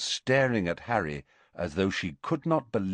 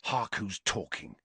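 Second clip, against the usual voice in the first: whispered; 8 dB quieter than usual. A narrator's reading. Harry whispering back to Hermione, quiet. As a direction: retort under your breath. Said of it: "he whispered back".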